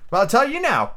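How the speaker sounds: put-on young lad, won over. Lad pledges himself to Silver, eager. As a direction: play an eager young sailor.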